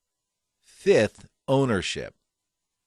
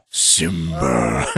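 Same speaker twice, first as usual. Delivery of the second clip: James Earl Jones take; 6 semitones lower than usual. Shown as a demonstration. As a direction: boom it out low. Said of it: "could you do that".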